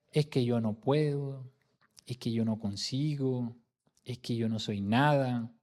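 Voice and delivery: slow, low, soft resignation; a touch of giving up; mock defeatism. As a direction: voice a mildly defeated excuse. Voicing defeatist expressions not to use.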